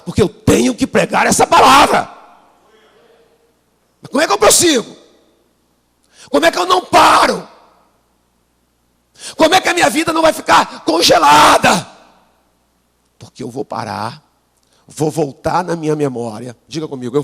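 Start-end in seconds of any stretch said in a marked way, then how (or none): 0.0-11.9 much higher, shouted preaching; preaching at full force. Real, not acted. Preacher shouting the question to his congregation. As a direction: build to a fiery climax.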